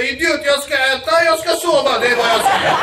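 high, loud indignant yell; uncle yelling. Retold or imitated. Old uncle yelling at the nephew over the mattress, loud and high. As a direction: bark it back like an offended old uncle.

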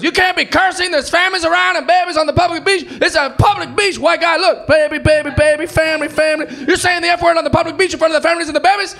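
high, loud hammed-up scolding; scolding beach guy. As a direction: wag a finger at the cursing tourist. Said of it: "He goes".

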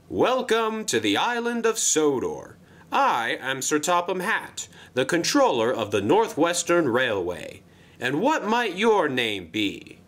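lightly pompous official introduction; Sir Topham Hatt. Acted. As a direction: greet a newcomer with ceremony. Sir Topham Hatt greets the newcomer.

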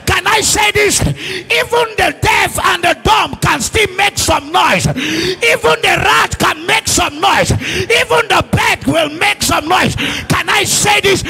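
forceful, full-voiced sermon; full-force preaching. Real, not acted. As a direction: press the point on the congregation.

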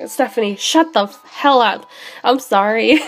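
ordinary talk, nothing marked

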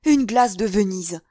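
theatrical dismay at full voice; exclaiming. A narrator's reading. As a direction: cry out over a precious loss. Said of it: "s'écria le cardinal".

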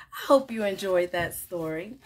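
usual speaking voice

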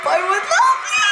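high excited shouted declaration; fan shout. Real, not acted. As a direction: scream it at the stage. Shouting at the performers.

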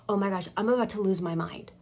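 retold panic. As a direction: blurt it out, at wit's end.

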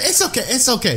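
put-on high Russian-accented reassurance; Russian grandmother. Nurse to bleeding patient, high Russian-accented reassurance. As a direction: wave away the problem as a Russian grandma. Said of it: "She said".

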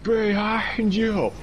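slow, drawn-out high warning; alarm shout. Real, not acted. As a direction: call out a warning. Warning teammate.

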